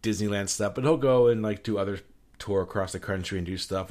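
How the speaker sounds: ordinary talk, nothing marked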